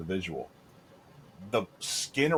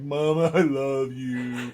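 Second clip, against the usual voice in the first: hammed-up teary plea, much higher and slower; drawn-out fake sob to mom; mock crying; 5 semitones higher, 4 dB louder, much slower than usual. Telling how he thanked his mom mid-procedure, mock crying. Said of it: "crying as she's squeezing".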